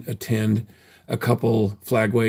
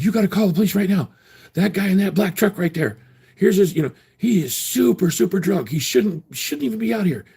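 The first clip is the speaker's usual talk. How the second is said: much higher, fast mock-alarmed reporter; mock panicky tattler; 8 semitones higher than usual. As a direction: imitate a busybody urging police. Mocking person at hotel door.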